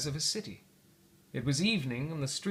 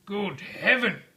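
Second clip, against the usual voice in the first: surprised; 5 semitones higher, 5 dB louder, slower than usual. Acted. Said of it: "said Scrooge".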